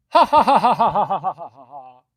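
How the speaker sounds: loud, high machine-gun ha-ha, fading away; long staccato cackle sliding down in pitch; fake hearty laugh. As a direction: force a big phony salesman laugh. Manager's phony laugh at caller's request.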